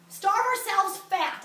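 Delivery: slow, loud, every word hit; slogan stress. Acted. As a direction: hammer home "Starve ourselves fat" slowly and loudly. Coins a catchphrase for the crowd.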